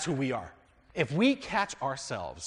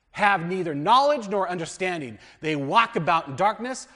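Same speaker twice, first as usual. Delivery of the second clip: raised, higher voice proclaiming the verse; scripture reading; 5 semitones higher, 10 dB louder than usual. Acted. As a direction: read it out like a preacher at the pulpit. Reads scripture aloud.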